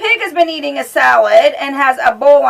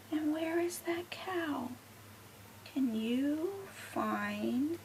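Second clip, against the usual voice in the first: hushed whisper, searching slowly; 16 dB quieter, slower than usual. Acted. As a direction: whisper as if sneaking up on the cow.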